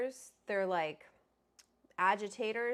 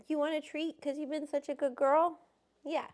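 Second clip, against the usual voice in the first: high, louder praising pet voice; 8 semitones higher, 4 dB louder than usual. Real, not acted. Owner offering her dog a treat in high baby talk.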